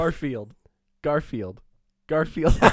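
Garfield run tightly together as two syllables; syllables close together. Shown as a demonstration. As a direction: say Garfield with no gap between Gar and field. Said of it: "when you put it close together".

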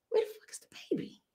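urgent high whisper through the teeth; panicked whisper. Retold or imitated. Teen mom on stage whispering to her DJ, panicked. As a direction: hiss it at your DJ without the crowd hearing. Whispering to Polo mid-rhyme.

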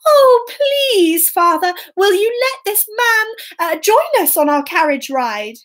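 pleading princess. Acted. Princess begs her father.

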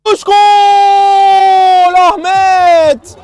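long drawn-out goal scream; screaming football commentator. Real, not acted. Goal call in live commentary. (bağıran maç spikeri)

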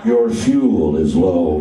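very low, slow butler voice; slow, deep English butler; Alfred the butler. Acted. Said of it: "he did sound like the butler from Batman".

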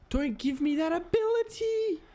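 high, rising plea; real high-pitched alarm; panicked protest. Real, not acted. Dreads being able to look behind.

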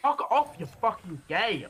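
furious yelled insult; swearing tirade. Real, not acted. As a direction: snap and shout at them. Angry outburst at game death.

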